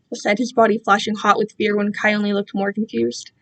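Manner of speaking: ordinary delivery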